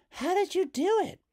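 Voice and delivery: put-on girl amazed at her mother; Hazel. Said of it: "said Hazel".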